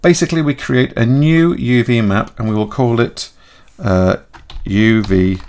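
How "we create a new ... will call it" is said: ordinary talk, nothing marked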